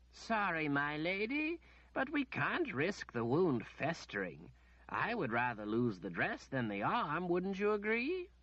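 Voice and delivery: a lightly voiced, patient old wizard; the wizard calmly answering the demanding lady. A narrator's reading.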